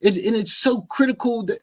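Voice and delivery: high, mocking imitation of the preacher. Mimicking the preacher's pompous musing to the listeners, lightly voiced. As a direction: lift the voice and ham up his pompous musing. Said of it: "And he was like".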